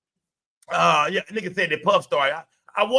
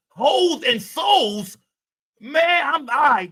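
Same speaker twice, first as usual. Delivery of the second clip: disgusted exclamation; 8 semitones higher, 5 dB louder, much slower than usual. Real, not acted. Reacting to chat titles.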